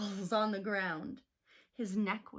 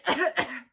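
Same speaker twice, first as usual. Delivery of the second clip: throat-clearing cough; polite little cough; 4 semitones higher, 8 dB louder than usual. Acted. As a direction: clear the throat to get attention. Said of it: "coughed a cricket".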